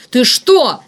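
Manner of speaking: loud, high-pitched yelp; startled squeal. A narrator's reading. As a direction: shriek it in alarmed fury. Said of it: "взвизгнул Игнат". (испуганно-злой взвизг)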